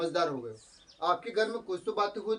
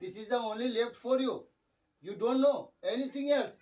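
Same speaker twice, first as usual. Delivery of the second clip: very slow, a little higher; slow English quote; 4 semitones higher, much slower than usual. Retold or imitated. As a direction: spell out each word for the class. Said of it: "बस उन्होंने ऐसा बोला है।".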